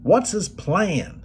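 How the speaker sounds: slow, drawn-out Tennessee twang. Retold or imitated. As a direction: stretch it out in a Southern Tennessee drawl. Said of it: "says to him in his Tennessee twang".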